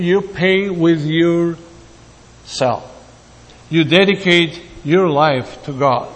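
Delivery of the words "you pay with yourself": slow emphatic point. Real, not acted. Key point of sermon.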